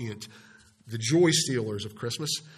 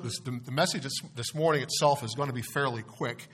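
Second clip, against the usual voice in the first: ordinary talk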